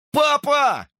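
high, held call; crying out. A narrator's reading. Boy calling his father from the van, crying out. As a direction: cry high to get a parent's attention. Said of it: "крикнул я".

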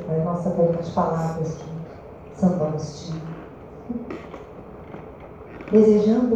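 low, soft meditative voice with long pauses; guided prayer meditation. Real, not acted. Speaker inviting the congregation to close their eyes and pray, guided meditation. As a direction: ease them gently into prayer.

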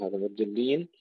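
ordinary delivery

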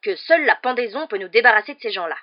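loud, high-pitched angry tirade; storming. A narrator's reading. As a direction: thunder it out in outrage. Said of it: "tempêta l'oncle Vernon".

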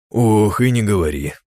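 loud hammed-up grumble; mock complaint. A narrator's reading. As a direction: complain theatrically. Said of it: "пожаловался наигранно Картар". (наигранная жалоба)

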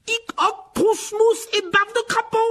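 very high, slow mock spell; theatrical fake magic words; fierce incantation. A narrator's reading. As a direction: intone it to scare him. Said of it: "d'une voix féroce".

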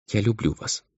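soft, high young girl's voice, lightly voiced; quiet and tender, a timid first confession. A narrator's reading. Girl confesses love to the half-demon, soft and tender. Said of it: "и сказала".

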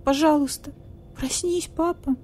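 high, soft frightened plea; scared. Acted. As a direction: beg like a scared child. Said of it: "Мне страшно тут одному".

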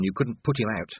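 ordinary delivery

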